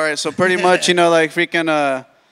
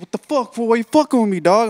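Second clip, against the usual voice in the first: higher-pitched, indignant confrontation; retold mad friend; 7 semitones higher than usual. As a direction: act out an angry friend's accusation. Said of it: "he comes in hella mad".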